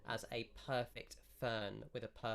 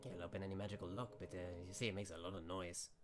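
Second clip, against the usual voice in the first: lightly voiced wizard giving a word of caution; a hint of an old hand explaining magic; matter-of-fact wizard; 3 semitones lower, 4 dB quieter, faster than usual. Game master voices the wizard.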